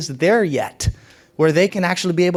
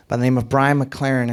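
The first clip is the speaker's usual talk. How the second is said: usual speaking voice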